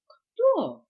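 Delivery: high, loud short question; surprised. A narrator's reading. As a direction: ask it, taken aback. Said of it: "удивился удав". (удивлённо)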